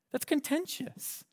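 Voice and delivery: a mocking squeak on contentious; mock high pitch.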